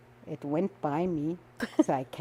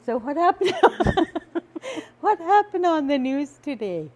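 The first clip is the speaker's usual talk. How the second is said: laughing through quote; 9 semitones higher, 6 dB louder than usual. Retold or imitated.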